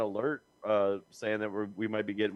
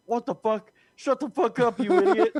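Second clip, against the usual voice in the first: much higher, louder yelled insult; retold yelling at his wife in the pantry; mock furious shout; 12 semitones higher, 8 dB louder than usual. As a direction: shout it as a startled outburst. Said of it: "that's how I said thank you".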